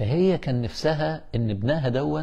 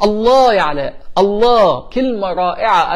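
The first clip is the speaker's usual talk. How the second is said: loud, higher burst of delight; إعجاب متحمس; 5 semitones higher, 6 dB louder, slower than usual. Real, not acted. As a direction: exclaim in real admiration. Delighted by a listener's message. (انبهار بصوت عالي)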